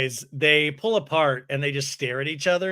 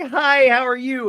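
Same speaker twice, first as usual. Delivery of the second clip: gushing greeting; 9 semitones higher, 7 dB louder than usual. Retold or imitated. Brittany greets Jared in the restaurant, gushing. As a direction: squeal hello like seeing a crush.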